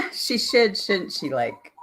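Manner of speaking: laughing it out high. Real, not acted. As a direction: giggle through the words. Laughing reply to a chat comment.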